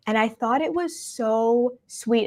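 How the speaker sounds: usual speaking voice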